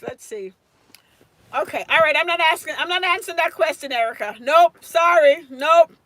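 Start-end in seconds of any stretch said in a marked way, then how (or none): 4.4-5.9 loud, bouncy no, no, no; playful loud refusals. Real, not acted. Teacher refusing to give the answer away, loud and playful. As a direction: turn the students down loudly and playfully.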